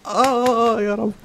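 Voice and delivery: high, fading cry to God; despairing wail. Real, not acted. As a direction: wail it out, beaten. Despair after failing. (آهة يأس رفيعة)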